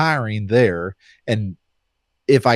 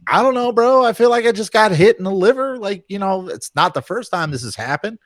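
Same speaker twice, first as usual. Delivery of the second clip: higher, breezy, mock-nonchalant; casual shrug; 9 semitones higher than usual. Fighter brushing off the doctor's worry, higher and breezy. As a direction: toss it off like it's nothing, pitched up.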